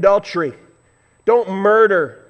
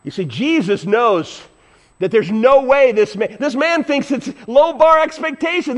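usual speaking voice

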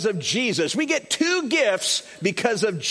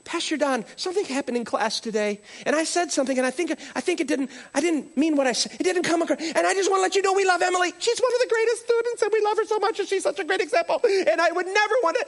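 high, fast, anxious apology; flustered apologetic teacher; 9 semitones higher, faster than usual. Retold or imitated. Teacher leaving panicked messages for Pastor Don, flustered. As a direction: gush out a nervous apology. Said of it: "She's calling".

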